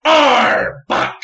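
high, loud cry of a Pokemon name; Pokemon shout. Retold or imitated. Wife shouting a Pokemon cry at Justin, loud and high. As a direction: belt it out proudly. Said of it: "She goes".

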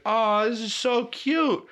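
high, slow, drawn-out imitation cooing; touched gushing. Girlfriend receiving gifts at her door, gushing. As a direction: imitate someone melting over a sweet surprise.

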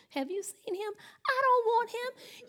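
high-pitched, scornful disbelief; comic, squeaky disdain; mock indignation. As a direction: act out dramatic offense, voice way up. Acts out indignant drama in church.

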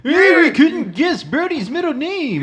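put-on high, slow creaky old man; hammed-up forgetful old man; playing old men ninety years old; old men voices. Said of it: "ninety years old".

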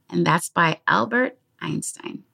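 ordinary delivery

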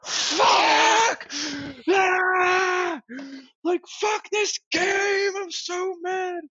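very high, drawn-out rage scream; full-on screaming. Retold or imitated. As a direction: re-enact screaming your fury through the apartment. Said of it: "I just started screaming around the apartment".

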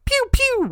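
high squeaky pew pew like a silenced pistol; silencer pew sounds. Retold or imitated. Narrating the assassin's silenced gun, mock pew pew. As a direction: mouth the little gunshots in a high voice.

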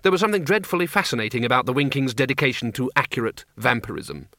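ordinary delivery, nothing marked

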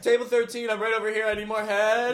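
high, loud joking call across the café; loud teasing call; mock customer calling out. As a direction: wave down the barista with the joke name.

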